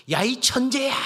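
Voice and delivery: loud, high holler of praise; mock mountain shout. Mother showing child what to yell at mountain, shouting. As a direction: call it out as if across a valley.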